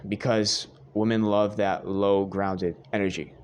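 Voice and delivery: ordinary talk, nothing marked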